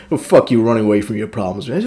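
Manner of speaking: low, soft, quick put-on reply; mocking retort. Silverhand rebuffing the player's offer, mocking low imitation. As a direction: mimic a dismissive comeback, dropped low.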